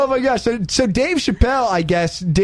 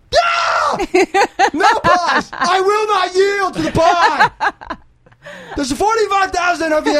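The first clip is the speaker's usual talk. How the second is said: hype man; 12 semitones higher, slower than usual. Pretend. Host playing a hype man backing up the speech, shouting. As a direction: bellow from the back to fire up the crowd. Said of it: "he needs a hype man in the back".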